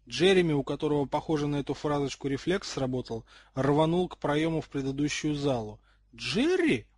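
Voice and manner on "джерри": high, startled question; surprised. A narrator's reading. The Gryffindor girl sees Jerry bolt, surprised cry. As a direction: call the name in astonishment, pitch leaping. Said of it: "удивлённо воскликнула Гриффиндорка".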